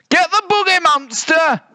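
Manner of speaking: littlest bear's rallying yell; squeaky-high battle order; high-pitched bellow. A narrator's reading. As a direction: bellow it high, like a small ringleader. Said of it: "ordered the little one".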